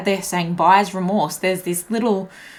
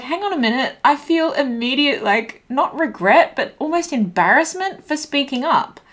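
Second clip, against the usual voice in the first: higher, a touch flustered; lightly voiced embarrassment, pitched up; embarrassed inner voice; 7 semitones higher than usual. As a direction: sound caught out by your own boldness. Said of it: "your voice is like".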